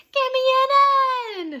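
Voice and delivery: high, loud cheer; cheerleader shout. A narrator's reading. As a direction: belt it like a pep squad. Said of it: "shouted the cheerleaders".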